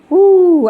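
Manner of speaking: loud, high "woo" sliding down; delighted whoop. Real, not acted. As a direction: let out a joyful whoop. Delighted by the verse's meaning.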